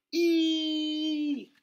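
very high, loud squeal of surprise; gleeful high-pitched shriek; delighted shriek. A narrator's reading. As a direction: squeal as cold water hits. Hosed down by Nana.

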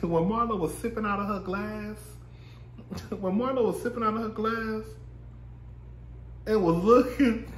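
can barely get it out for laughing. Real, not acted. Laughing while describing Marlo sipping from her glass.

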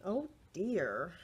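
ordinary delivery

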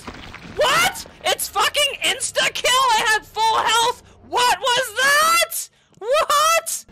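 high, drawn-out shouts of disbelief; incredulous outrage. Real, not acted. Streamer outraged at the game's insta-kill, incredulous shouting. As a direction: yell it, pitch shooting up in outrage.

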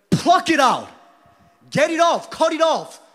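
high, loud shouted orders; shouted command. Acted. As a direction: bark each command from the pulpit. Preacher commanding cutting off sin.

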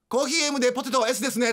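high, fast order read-back; shrill service voice. Acted. Mother repeats student's order wrongly, high-pitched and quick. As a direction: race through the order up high. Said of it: "すごい甲高い声で".

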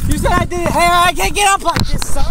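high, loud, desperate shouting; angry yelling. Real, not acted. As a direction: yell it back in protest. Handcuffed suspect yelling at officer.

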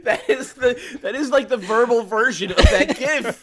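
high, loud laughing jab; cackling at a friend's slip; laughing tease. Real, not acted. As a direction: crack up while teasing a friend. Teasing laughter at co-host.

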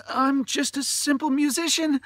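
very high, loud, panicked pleading; frightened cry. A narrator's reading. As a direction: insist you are harmless, terrified. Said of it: "he cried".

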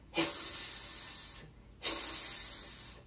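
long voiceless hiss; cat hiss. Shown as a demonstration. Reader leading children in hissing like this cat. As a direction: draw out a cat's hiss. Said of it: "Let's try hissing".

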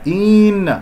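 إن with the first vowel lengthened to "een"; wrong stretched kasra. Shown as a demonstration. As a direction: drag the i of إن too long. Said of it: "هذه الكسرة للهمزة لا تمط". («إن» بمط كسرة الهمزة خطأً)

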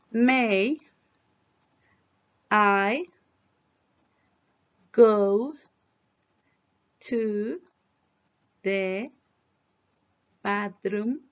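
each word said separately with long pauses; slow word by word. Shown as a demonstration. Teacher modelling the question word by word, slowly. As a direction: space out every word for the class. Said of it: "Vamos a pronunciar esto".

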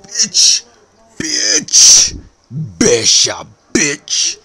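loud high repeated screams; screaming. Acted. Screaming at Santa.

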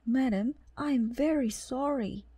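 apologetic cat voice. A narrator's reading. Cat busboy apologizing to lady.

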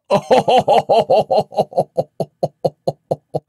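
long, loud, high hooting laugh dying away; hearty theatrical laugh, slowly tailing off; chuckling. A narrator's reading. Villager amused by the knight's confession, laughing heartily. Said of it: "chuckled Crispin".

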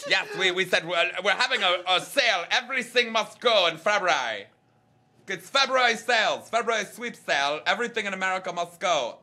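put-on German accent. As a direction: hawk a sale in a hammed-up German voice. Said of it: "in a German accent".

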